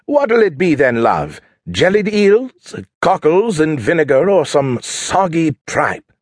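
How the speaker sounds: high, drawn-out, hammed-up British; horrible British accent. Husband offering his wife lunch, horrible British accent. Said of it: "poured on his horrible British accent".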